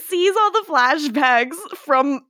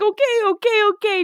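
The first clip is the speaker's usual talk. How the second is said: mock surrender; 10 semitones higher, faster than usual. Cole giving in to Davina.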